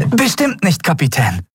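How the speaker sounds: high, joyful assurance; beaming. Acted. Miko beaming at the captain, joyful. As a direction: promise it with a grin. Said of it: "erklärte Miko freudestrahlend".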